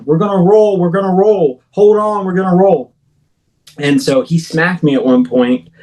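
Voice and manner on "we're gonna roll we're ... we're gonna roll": high, frantic yelled warning, over and over; retold scream. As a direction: shout it like the truck is about to roll. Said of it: "he's just screaming".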